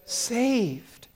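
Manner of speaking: high prompting answer; cue word. Shown as a demonstration. Preacher prompting the kids to supply the verse's last word, high and drawn. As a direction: lift the word to invite the kids to join. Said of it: "what's the last word?".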